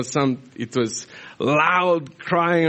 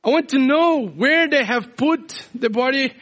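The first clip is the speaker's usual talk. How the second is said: desperate Mary; 9 semitones higher than usual. Retold or imitated. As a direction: refuse to leave, voice raised high and urgent. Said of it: "And Mary saying".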